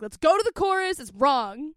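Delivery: confident bandleader; assertive. Retold or imitated. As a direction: say it without apology. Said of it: "It should be like".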